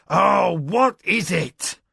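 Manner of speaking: loud, high, slow irritated demand; nettled snap. Acted. Annoyed lord snaps at his wife's interruption. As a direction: bite out the question impatiently.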